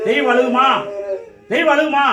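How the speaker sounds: loud, high, insistent question; shouted challenge. Real, not acted. Medium challenges whether a god would cry, shouted challenge. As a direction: hurl the question as a rebuke. Challenge repeated with mounting force.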